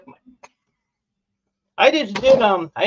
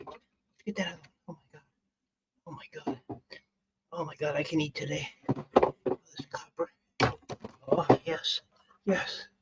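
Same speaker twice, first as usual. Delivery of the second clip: hushed awe; 2 semitones lower, 7 dB quieter than usual. Real, not acted. Hushed awe over found copper.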